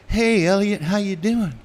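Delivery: mimicking the strong simple roadie; simple guy's greeting. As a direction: do Dean's friendly simple greeting. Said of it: "He was always like".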